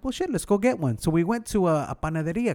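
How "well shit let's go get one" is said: eager self-quote. Retold or imitated. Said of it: "So I'm like".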